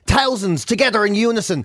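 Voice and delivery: ordinary talk, nothing marked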